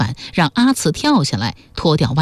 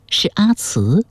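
usual speaking voice

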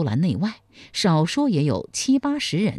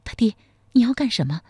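put-on alarmed old wife; 7 semitones higher, faster than usual. A narrator's reading. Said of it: "说".

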